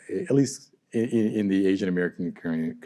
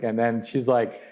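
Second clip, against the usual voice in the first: usual speaking voice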